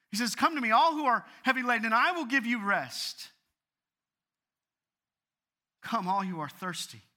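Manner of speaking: loud, high gospel appeal; ringing pulpit call; impassioned preaching. Real, not acted. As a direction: proclaim scripture at full force to the congregation.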